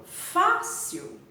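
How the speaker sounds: "fácil" with FÁ punched, second syllable dropping; stress on first syllable. Shown as a demonstration. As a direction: hit the start of "fácil" hard. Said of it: "a sílaba forte é fá". ("fácil" com força na primeira sílaba)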